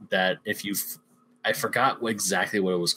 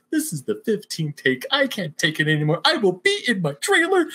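high, put-on diva huff; mock diva Hopper; 9 semitones higher than usual. Hopper actor quitting after the fifteenth take, in a high mock huff. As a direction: storm off like a fed-up star. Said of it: "He's like".

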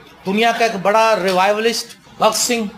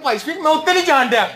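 raised-pitch Punjabi plea, playfully imitated; mock Punjabi plea; 6 semitones higher than usual. Believers mocked while begging to be let off, in playful Punjabi. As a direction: lightly mimic a villager's Punjabi complaint. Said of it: "کہہ رہے ہوں گے".

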